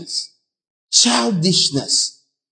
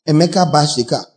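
ordinary delivery

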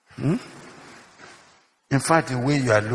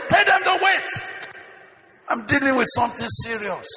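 irritated rebuke; 12 semitones higher than usual. Acted. Pastor rebuking the secretary who knocked, irritated and high-pitched. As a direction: shout it like a busy pastor cutting someone off.

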